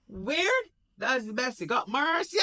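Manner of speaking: high, scoffing shout at the screen; incredulous high-pitched scoff; Michael mocking. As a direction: jeer it out, high and loud. Michael scoffs at email insult.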